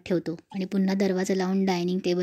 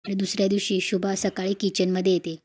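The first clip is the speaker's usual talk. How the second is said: plain reading voice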